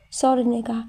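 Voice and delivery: usual speaking voice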